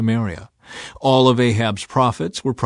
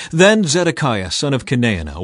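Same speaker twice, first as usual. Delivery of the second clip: usual speaking voice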